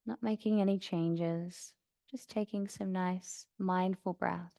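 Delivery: soft, even meditation voice with long pauses; guided meditation. Acted. Yoga teacher guiding class to notice sounds around them, calm meditation voice. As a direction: settle them gently into stillness, unhurried.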